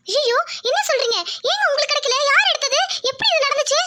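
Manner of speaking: high-pitched, dismayed questions; incredulous wife reeling at bad news; shocked. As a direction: react in shock, voice jumping up. Wife hears the auction was lost.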